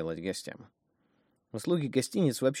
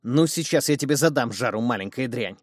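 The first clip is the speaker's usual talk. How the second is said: angry; 3 semitones higher, 7 dB louder than usual. Acted. Woman furious at her daughter.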